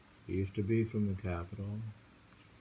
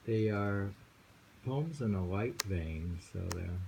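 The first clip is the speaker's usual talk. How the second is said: usual speaking voice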